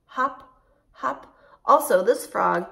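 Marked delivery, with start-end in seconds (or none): 0.1-1.4 higher bouncy calls, one per hop; spring each word up like a hop. Shown as a demonstration. Teacher calling out hops for the kids, higher and bouncy. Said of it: "hopping like a frog".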